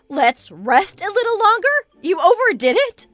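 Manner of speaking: high, loud indignant questions; incredulous echo. Acted. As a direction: throw the words back in disbelief. Wife throws back friend's words.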